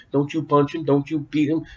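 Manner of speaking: ordinary talk, nothing marked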